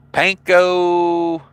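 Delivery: "Panko" drawn out, no L after the P; "Panko" said slowly as PAN-koh; Panko without L. Shown as a demonstration. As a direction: stretch out "Panko", starting pan, ending koh. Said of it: "there is no L".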